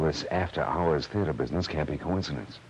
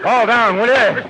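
straining shout; 11 dB louder, slower than usual. Acted. Fistfight, straining against attacker.

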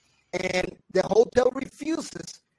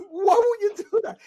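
much higher, half-laughing exasperated cry; 14 semitones higher, 3 dB louder than usual. Retold or imitated. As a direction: wail at her in disbelief. Exasperated son to mother.

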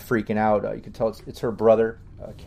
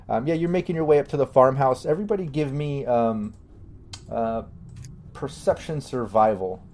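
ordinary delivery

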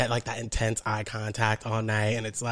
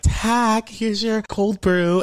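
barista voice; 9 semitones higher, 5 dB louder, much slower than usual. Retold or imitated.

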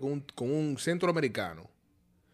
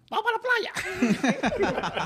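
much higher, slow mocking classmate voice; mocking squeaky voice; much slower than usual. Mocking a classmate inviting him to the beach, high squeaky voice. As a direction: squeak it out to mock the kid.

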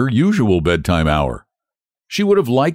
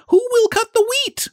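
much higher, brisk calling hen. Acted. Hen asks the animals to cut wheat, brisk high call. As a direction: call out briskly to get help. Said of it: "calling briskly".

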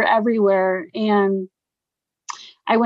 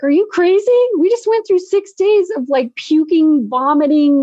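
high, loud-ish retold disbelief; acted-out exasperated astonishment; incredulous protest; 7 semitones higher, 3 dB louder than usual. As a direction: protest as if the idea is nuts. Retold incredulous reply to friend.

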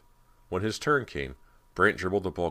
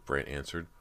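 ordinary delivery, nothing marked

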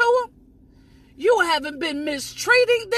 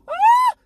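a high, loud, ecstatic woo; joyful whoop; 13 semitones higher, 6 dB louder than usual. Real, not acted. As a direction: let the spirit burst out in a whoop. Preacher whooping mid-sermon.

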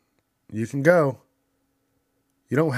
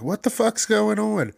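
alarmed; 11 semitones higher, 4 dB louder than usual. Retold or imitated. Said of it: "people are gonna be like".

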